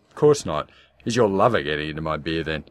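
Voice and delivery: loud, fast and biting; sharp retort. Acted. Wife firing back at husband about a lover, sharp and fast.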